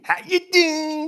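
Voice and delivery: high, loud New York greeting; hammed-up New York accent. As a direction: punch the New York catchphrase. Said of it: "a whole nother accent".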